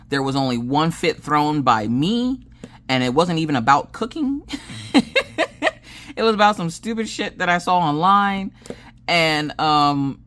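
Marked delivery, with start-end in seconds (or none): 4.5-6.1 high, breath-filled giggling; giggle. Real, not acted. Mom laughing at her own fit, giggling.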